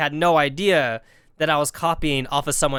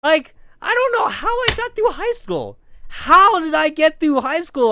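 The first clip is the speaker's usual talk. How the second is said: much higher, louder disbelief; incredulous rant; 10 semitones higher, 5 dB louder than usual. Real, not acted. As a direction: squeal in disbelief at yourself.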